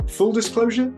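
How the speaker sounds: usual speaking voice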